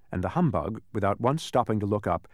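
usual speaking voice

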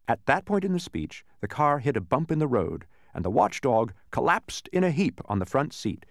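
plain reading voice

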